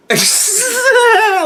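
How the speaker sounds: sudden high, loud laugh; high burst of laughter. Real, not acted. Vlogger laughs loudly while admitting he's lost. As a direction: break into an embarrassed laugh.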